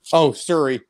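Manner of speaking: ordinary delivery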